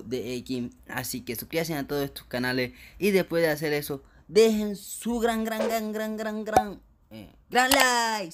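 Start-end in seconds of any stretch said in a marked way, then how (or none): 4.3-8.3 high, slow drawn-out gran gran gran; stretched high hype. Pretend. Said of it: "Sí, dejen su gran like".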